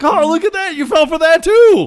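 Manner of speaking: very loud, high-pitched taunt; hammed-up shout of disbelief; mock gloating. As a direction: jeer at someone fooled twice. Acted taunt at fooled opponent.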